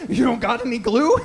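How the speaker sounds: normal talking voice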